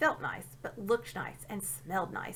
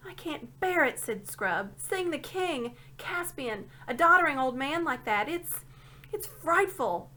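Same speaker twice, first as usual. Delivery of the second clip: distressed; 6 semitones higher, 3 dB louder, slower than usual. A narrator's reading. Scrub distressed.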